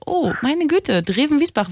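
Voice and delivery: hammed-up wonder at a village name; mock amazement. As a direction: play up a small town as if it were grand. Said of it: "eine starke Reaktion bringen".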